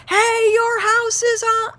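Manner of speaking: high, loud, slow alarm call; alarmed shout. Retold or imitated. Dad running into the backyard to warn the neighbors, high alarmed yell. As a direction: yell a warning across the yard.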